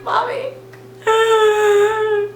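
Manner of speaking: high-pitched, drawn-out mock crying; whining. Student phoning mom about period pain, hammed-up whining. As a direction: wail it like a whiny child. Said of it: "I'm just whining".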